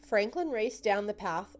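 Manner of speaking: ordinary delivery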